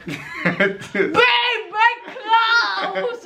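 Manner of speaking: imitating his desperate call up to the window; laughing mock yell. Imitating naked boyfriend calling up for clothes, laughing mock yell.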